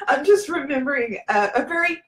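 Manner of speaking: laughing through real tears; good cry. Real, not acted. Woman telling a worried viewer she's fine, happily tearful. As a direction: reassure a friend while tearing up. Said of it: "it's a good cry".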